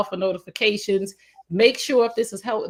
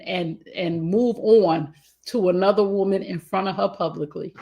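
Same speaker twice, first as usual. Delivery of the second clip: ordinary delivery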